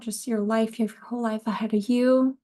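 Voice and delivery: ordinary talk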